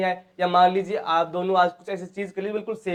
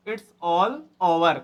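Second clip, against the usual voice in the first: It's all over said very slowly, each word drawn out; slow repeat; 3 semitones lower, much slower than usual. Shown as a demonstration. Teacher repeats the phrase slowly for students. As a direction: spell it out slowly for the learners. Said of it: "slow demo repeat".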